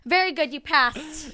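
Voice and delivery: slow, over-bright official approval; mock examiner praise. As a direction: play an examiner announcing a pass, drawn out. Mimics examiner's congratulation.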